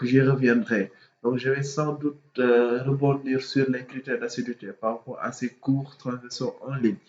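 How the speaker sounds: ordinary talk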